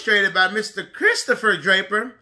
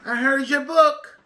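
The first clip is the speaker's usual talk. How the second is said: put-on child greeting author; 5 semitones higher, 3 dB louder, slower than usual. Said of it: "you can say".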